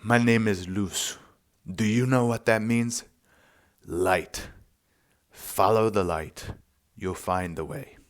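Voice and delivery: imitated thick South American accent; retold woman's voice in a South American accent. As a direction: put on a South American accent, measured and deliberate. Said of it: "with this thick South American accent".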